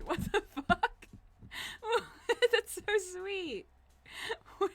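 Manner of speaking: high giggly gush; delighted high laugh then sweet gush; laughing squeal. Real, not acted. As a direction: laugh while gushing it out. Laughing at friend's gift.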